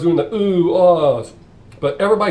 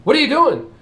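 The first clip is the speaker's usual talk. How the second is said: high, louder surprised question; startled question; 12 semitones higher, 5 dB louder than usual. Acted. Priest surprised as the nun dresses, startled. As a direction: sound caught off guard.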